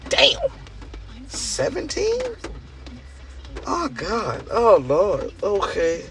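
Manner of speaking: mock horror at how long it's been; shocked disbelief. Reading his hire date at work, a high, shocked squeak then a deflated sigh.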